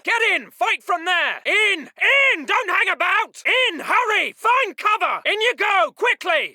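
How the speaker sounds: high, pressing shout; urgent shouted order. Acted. Officer hurrying chaps inside the building, shouting urgently. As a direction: shout them into cover. Officer hurries men into cover.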